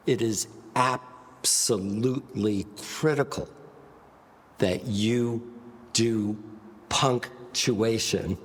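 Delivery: ABSOLUTELY CRITICAL broken up, each piece set apart; punctuated delivery. Shown as a demonstration. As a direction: punch "you", "do", "punctuation" separately with pauses. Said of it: "what are you doing when you punctuate".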